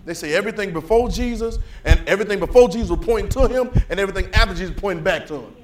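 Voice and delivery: loud, climbing sermon delivery; preaching at full force. Acted. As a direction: preach it out, rising and shouting.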